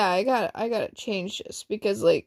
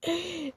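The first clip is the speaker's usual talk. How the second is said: a real high burst of laughter; 9 semitones higher, 9 dB quieter than usual. Real, not acted. Reader cracks up at her own story, high laughter. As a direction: crack up at the silly scene. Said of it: "I burst into laughter".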